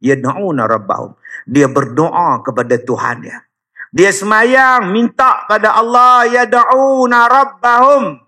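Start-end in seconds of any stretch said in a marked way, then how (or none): 1.5-6.3 raised, higher preaching voice; fiery preaching. Acted. Opening preaching at full force. 6.3-8.2 higher and drawn out, recited slowly; slow recited verse. Shown as a demonstration.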